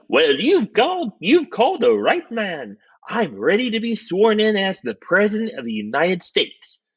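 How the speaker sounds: high-voiced, self-promoting vice president; eager vice president. Vice president figure, eager for office.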